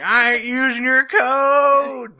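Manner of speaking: loud, drawn-out mock-stubborn shout; mock defiant yell. Barista feuding over North versus South drink codes, defiant yell. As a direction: holler it high, dragging out the last word.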